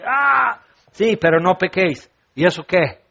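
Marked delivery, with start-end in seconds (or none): 0.1-0.6 high-pitched acted outburst; mock yell of bottled-up anger; explosive cry. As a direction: let out a bursting "ah". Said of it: "para explotar ya aquí el pecho".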